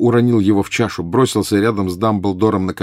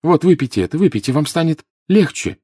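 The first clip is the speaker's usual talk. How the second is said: high, urgent, despairing coaxing; pleading with rising despair; desperate plea; 8 semitones higher, 3 dB louder than usual. A narrator's reading. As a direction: beg him desperately to take it. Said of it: "с отчаянием попросил Гарри".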